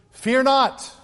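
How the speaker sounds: herald's loud fear not; proclaiming angels. Acted. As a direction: announce it like an angel. Quoting angels' greeting.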